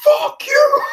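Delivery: high, loud hammed-up yell; cinema heckler. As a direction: holler it from a cinema seat. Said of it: "you hear them saying".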